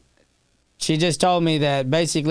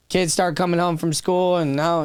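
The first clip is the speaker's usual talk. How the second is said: ordinary talk, nothing marked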